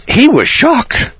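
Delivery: theatrical high slow exclaim; dramatic shocked telling. Real, not acted. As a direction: stretch the word shocked way up high.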